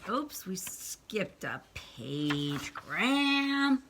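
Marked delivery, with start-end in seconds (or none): none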